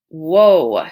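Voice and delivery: normal talking voice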